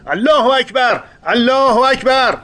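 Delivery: high-pitched jubilant battle cry; elated shout. Acted. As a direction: cry it out with joy and excitement. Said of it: "با شوق و ذوق و هیجان بالای اتاقک فریاد کشیدم". (فریاد پرشور از سر ذوق)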